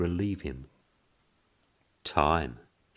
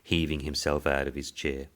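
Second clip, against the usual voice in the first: ordinary delivery, nothing marked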